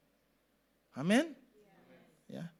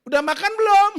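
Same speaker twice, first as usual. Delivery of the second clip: much higher and far louder, snapping; picking a fight; 19 dB louder than usual. Shown as a demonstration. Preacher showing the same question said to start a fight, loud. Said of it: "Itu ngajak berantem".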